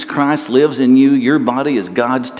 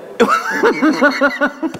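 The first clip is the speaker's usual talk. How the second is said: a high, bright burst of real laughter; 11 semitones higher than usual. Real, not acted. Laughing at his own joke to the congregation.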